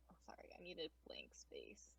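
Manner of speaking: soft unvoiced aside; whispered aside. Real, not acted. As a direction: whisper it under your breath. GM aside to self.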